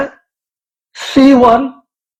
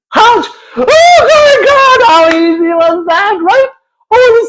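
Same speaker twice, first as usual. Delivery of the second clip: mock triumph; 12 semitones higher than usual. Mock-cheering a friend for saying his name. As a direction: cheer like he's done something huge. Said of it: "triumphant mock praise".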